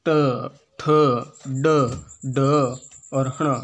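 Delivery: ट ठ ड ढ said one at a time with pauses; letters recited one by one. Shown as a demonstration. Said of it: "देखो पहले री". (मूर्धन्य वर्ण एक-एक करके)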